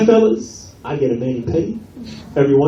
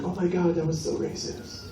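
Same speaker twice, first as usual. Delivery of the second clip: offended hushed woman; 2 semitones higher, 13 dB quieter than usual. Retold or imitated. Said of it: "She's like".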